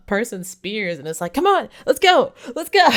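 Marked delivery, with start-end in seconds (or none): 1.3-2.9 high, loud rallying yell; fired-up shout to the whole tribe; battle cry. Retold or imitated. Lily grabs spear to fight.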